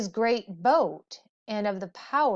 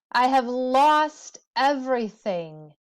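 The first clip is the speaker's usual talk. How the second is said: louder, raised lament; 4 semitones higher, 6 dB louder than usual. A narrator's reading. Gilgamesh lamenting everything lost, raised and loud. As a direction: cry out the loss. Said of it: "he lamented".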